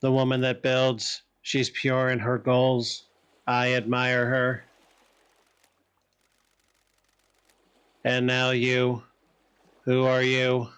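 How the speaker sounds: slow, level, deliberate pronouncements; translated character. Acted. Creature sizes up the visitors and questions one, measured. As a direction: intone each phrase evenly, with measured gaps.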